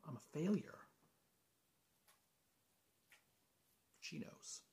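very soft, fading to near whisper; hushed confession. Acted. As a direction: barely let the admission out. Admits failure, wife knows.

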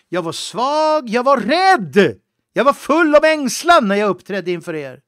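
loud, impassioned sermon delivery; fervent preaching. Acted. Preacher confessing his own fear to the congregation, loud and fervent. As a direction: preach it hard, voice swelling on every line.

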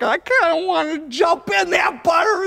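high, loud gleeful shout; excited shout. Retold or imitated. Kid at the butter vat, gleeful shout. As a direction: cry out with greedy glee. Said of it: "it was just like".

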